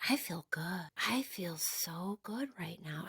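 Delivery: soft, slow whisper of pure contentment; blissed whisper. Retold or imitated. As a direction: breathe it out like you are melting. Recalling relaxed high, whispered.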